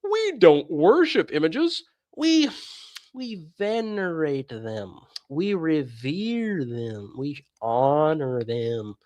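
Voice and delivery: slow, drawn-out mock of an evasive idolater; preacher mocking the idolaters' vague words. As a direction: sneer out their excuse slowly.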